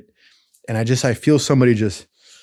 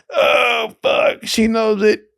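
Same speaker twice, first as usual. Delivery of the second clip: much higher, shouted in disbelief; amazed shouting; 10 semitones higher than usual. Retold or imitated. Teller reliving the crowd's reaction, much higher shouted disbelief. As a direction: yell it like the whole street just saw it. Crowd's laughing awe retold.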